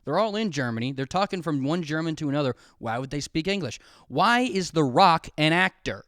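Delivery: normal talking voice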